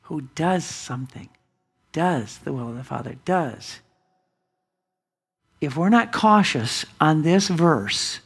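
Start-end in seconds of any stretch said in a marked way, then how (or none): 0.0-3.8 does pressed on, voice low and soft; stress on does. Shown as a demonstration. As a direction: lean on does. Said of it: "goes back to one word".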